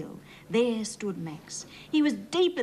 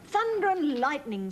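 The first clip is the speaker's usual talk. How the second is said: relieved shout; 7 semitones higher than usual. A narrator's reading. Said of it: "shouted Farmer Klaus".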